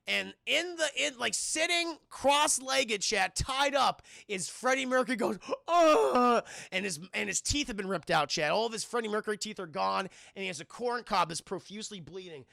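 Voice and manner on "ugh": loud, high mock wail; exaggerated moan of distress; imitated groan. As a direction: groan like a gagged captive, hammed up. Imitates tied-up toothless victim.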